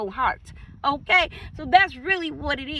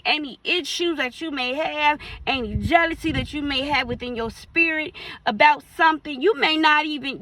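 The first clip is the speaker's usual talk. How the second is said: ordinary delivery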